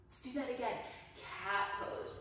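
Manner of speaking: usual speaking voice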